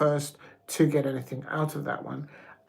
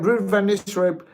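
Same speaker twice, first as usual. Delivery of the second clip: ordinary talk, nothing marked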